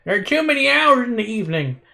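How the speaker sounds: hammed-up rural country accent, high and loud; Billy Bob country accent, big and high. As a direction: play a loud, over-the-top country bumpkin. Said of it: "I'll try out the country accent".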